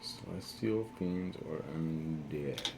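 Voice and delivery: low muttered words; muttering quietly. Acted. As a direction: mutter it under the breath.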